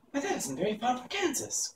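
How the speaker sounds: high, eager exclamation; eager cry. A narrator's reading. Dorothy to Oz, eagerly surprised. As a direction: blurt it out, delighted. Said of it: "cried Dorothy".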